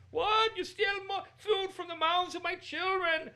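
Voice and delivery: very high, loud, slow melodramatic wail; outraged market seller. Retold or imitated. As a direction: ham up the haggler's wounded outrage. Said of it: "you go to buy something in the markets".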